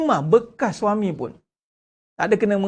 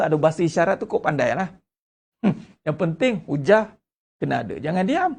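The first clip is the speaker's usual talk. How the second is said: usual speaking voice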